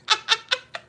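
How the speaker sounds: short high laugh; a brief high chuckle in spaced bursts. Real, not acted. Telling Niecy it's true, high chuckle.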